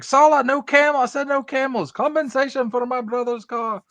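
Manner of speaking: hammed-up high exasperated shout; Indy yelling at Sallah. Quoting Indy scolding Sallah over the camels, high mock yell. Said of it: "He's like".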